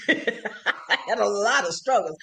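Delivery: laughing as she admits it; real laugh running into slow, drawn-out words. Real, not acted. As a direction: let a laugh break into the words. Wry laugh about past struggles.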